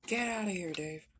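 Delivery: sudden loud, high yell to send someone off; annoyed shout. Acted. Missus Rosie snaps at Dave to leave, annoyed shout. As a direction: shout them out of the room.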